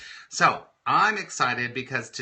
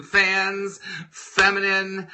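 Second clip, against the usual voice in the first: ordinary talk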